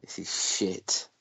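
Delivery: muttered quote. Retold or imitated. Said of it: "he must have been backstage going".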